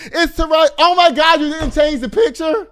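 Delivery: high, loud shocked outburst; gasping at the wrong photo on screen. Real, not acted. Host spotting the wrong birthday photo, loud and shocked.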